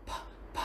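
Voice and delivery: "p" bật hơi ngắn, không kèm nguyên âm; bật "p" khẽ, gọn; âm p cuối. Shown as a demonstration. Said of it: "âm cuối cùng của từ đó là p". (thầy đọc mẫu âm "p" cuối từ)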